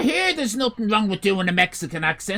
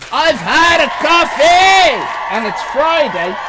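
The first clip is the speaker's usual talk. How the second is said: slow, loud, high, drawn-out exclamation; buzzing from the coffee, shouting it; caffeinated shout; 8 semitones higher, 9 dB louder, slower than usual. Shown as a demonstration. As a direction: announce the caffeine buzz to everyone.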